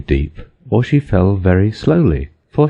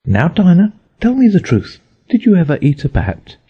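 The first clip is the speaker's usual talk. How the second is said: earnest; 10 semitones higher than usual. A narrator's reading. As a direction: press the cat for the truth. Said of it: "saying to her very earnestly".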